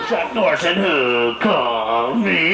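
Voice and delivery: low slow announcer read; deep trailer voice. Acted. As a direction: say the title like a movie trailer. Trailer title redone low.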